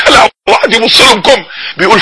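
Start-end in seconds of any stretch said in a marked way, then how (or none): none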